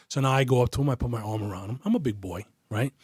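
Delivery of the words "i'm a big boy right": ordinary delivery, nothing marked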